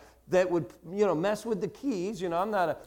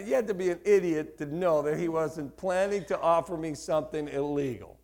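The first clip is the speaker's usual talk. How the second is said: ordinary talk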